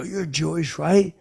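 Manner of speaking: high question, loud on the tail; insistent repeat. Retold or imitated. Repeats question after a what.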